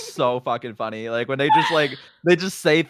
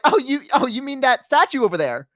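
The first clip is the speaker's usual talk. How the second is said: high, over-bright cover-up; feigned innocence; 9 semitones higher than usual. Retold or imitated. Babysitter covering a kid's rude remark, high fake innocence. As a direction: pitch it up, pretending to misunderstand. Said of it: "and I was like".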